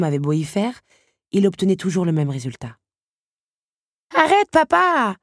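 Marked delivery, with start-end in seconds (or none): 4.1-5.2 put-on high, loud, drawn-out child's plea; imploring child. A narrator's reading. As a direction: beg your father to stop, high and loud. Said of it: "avait fini par implorer l'enfant". (enfant qui implore)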